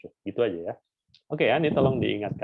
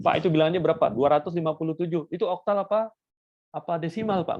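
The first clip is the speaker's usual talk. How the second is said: higher, louder mock student asking a question; imitating a student; 7 semitones higher, 3 dB louder, faster than usual. Teacher mimics a student asking him, higher, louder. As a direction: play the confused student asking "Pak". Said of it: "ada yang nanya lagi".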